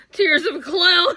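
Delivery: high, loud, slow tearful laugh-cry; laughing to tears. Real, not acted. Makeup artist using her own tears on the liner, loud tearful laughing. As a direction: wail it slowly while cracking up. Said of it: "a little of my own tears".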